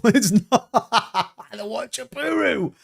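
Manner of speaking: high, slow words through laughter; laughing. Real, not acted. Streamer cracking up over a chat joke, laughing through it.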